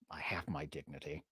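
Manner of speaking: a low, mock-solemn whisper; hushed. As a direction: breathe it out deep and low. Mock dignity after repeating.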